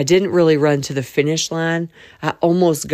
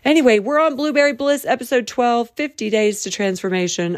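much higher and louder, slowed; bright announcer burst; excited plug; 7 semitones higher, 3 dB louder than usual. Real, not acted. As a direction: hype it up like a host.

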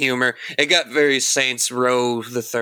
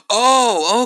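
surprised gasp; 10 semitones higher, 3 dB louder than usual. Real, not acted. Gasps at Frank West.

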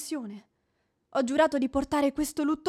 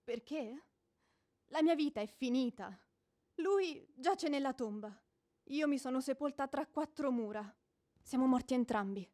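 grieving hush; 8 dB quieter than usual. Acted. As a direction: speak as if from inside the grave. Widow mourning her dead husband.

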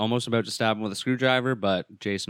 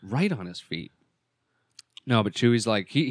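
normal talking voice

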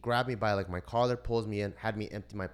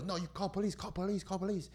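insistent dad; 11 semitones higher than usual. Retold or imitated. Retells dad insisting on police.

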